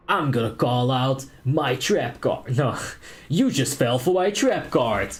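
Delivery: slow, hammed-up dub villain calling a card; cheesy anime dub. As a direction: draw it out like a cheesy cartoon duelist. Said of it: "it just sounds like cheese to me".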